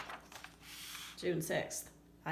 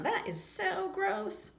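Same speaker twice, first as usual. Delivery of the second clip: much higher, slow drawn-out disgust; 11 semitones higher, slower than usual. Acted. Worm reacting to the molted skin, high slow disgust. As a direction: pull the words long in a high grossed-out voice. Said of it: "Worm's going".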